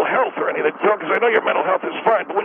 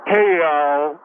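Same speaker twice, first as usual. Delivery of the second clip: imitating [name]; 6 semitones lower than usual. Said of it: "she got like".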